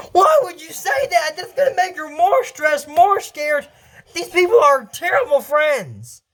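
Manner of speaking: high-pitched indignant protest at the screen; high outraged reaction. Real, not acted. Viewer protesting at the cartoon, high and outraged. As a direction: squeal in outrage at these friends.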